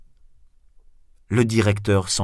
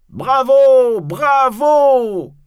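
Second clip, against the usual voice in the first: very high, loud, drawn-out bravo; crowd's full-voiced acclaim; cheering shout; 9 dB louder, much slower than usual. A narrator's reading. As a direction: cheer like a thrilled audience. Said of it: "crient les spectateurs".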